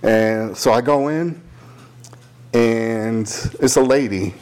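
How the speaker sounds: ordinary delivery, nothing marked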